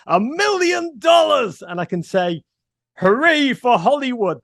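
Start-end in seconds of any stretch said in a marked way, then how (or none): 0.1-1.6 high, slow, exaggerated American accent; hammed-up American twang, stretched out; attempted American accent. Shown as a demonstration. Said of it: "can't even do an American accent". 3.0-4.4 high, loud put-on American accent; Englishman's showy attempt at American; vaguely American accent. Shown as a demonstration. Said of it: "can say in vaguely American".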